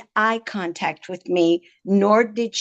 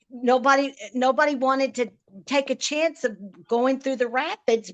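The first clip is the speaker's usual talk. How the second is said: ordinary delivery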